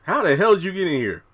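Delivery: startled question. Retold or imitated. Retelling John's shocked question.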